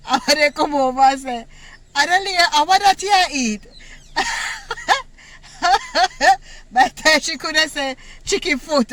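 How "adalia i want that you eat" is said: a higher, louder call to a classmate. Retold or imitated. Schoolchildren teasing the girl over her lunch, loud higher call. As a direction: call her name across the room, mocking.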